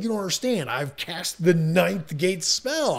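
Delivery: high-pitched, hammed-up outrage; mock indignation. Spellcaster to the man who doesn't believe him, mock pissed off. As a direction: insist on it, imitating an angry villain. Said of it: "like, so pissed".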